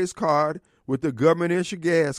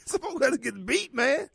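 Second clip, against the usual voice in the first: said through a laugh, higher; a joking line half laughed; 7 semitones higher, 3 dB quieter than usual. Real, not acted. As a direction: laugh the words out.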